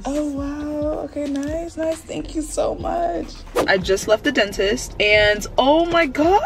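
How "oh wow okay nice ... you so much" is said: quieter, higher hushed thanks; soft awed thanks. Real, not acted. Vlogger thanking dentist softly for a toothbrush, hushed and higher. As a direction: breathe the thanks out, softly delighted.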